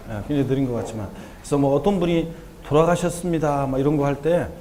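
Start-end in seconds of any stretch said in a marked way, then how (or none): none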